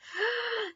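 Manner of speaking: high drawn-in gasp; mock amazed gasp at the speed. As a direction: suck in air audibly.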